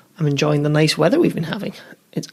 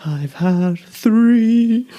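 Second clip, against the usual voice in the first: too much caffeine; 6 semitones higher, much slower than usual. Real, not acted. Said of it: "And I think it shows".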